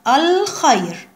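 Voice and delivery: الخير drawn out slowly, with a clear, held L in "al"; pronounced moon lam. Shown as a demonstration. Teacher shows pupils the moon lam is pronounced, الخير with a long clear L. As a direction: sound the L fully in الخير, stretching it out. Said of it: "تنطق وتكتب".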